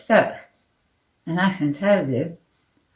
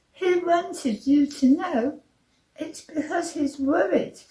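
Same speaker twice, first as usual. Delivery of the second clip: high-pitched, anxious little voice; Nervous, timid little man; 9 semitones higher than usual. A narrator's reading. Little man explaining why feet stick, nervous.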